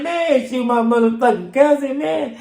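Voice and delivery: very high, loud exasperated protest; retold outraged protest. Protesting to the officer, very high and loud. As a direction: squeal it out, outraged.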